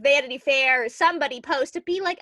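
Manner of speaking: normal talking voice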